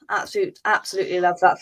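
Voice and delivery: ordinary delivery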